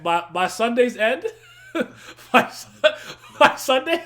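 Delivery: high, breaking laugh-cry; laughing so hard the words break; laughing to tears. Real, not acted. Laughing so hard it sounds like crying.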